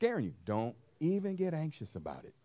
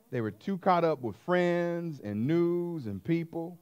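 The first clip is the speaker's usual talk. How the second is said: ordinary delivery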